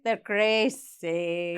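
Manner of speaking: drawn-out goofy voice; slow put-on voice. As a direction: stretch it slowly in a silly voice. Echoing slowly in put-on voice.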